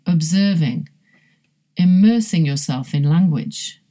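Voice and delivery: ordinary talk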